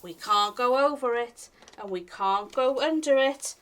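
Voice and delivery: ordinary delivery, nothing marked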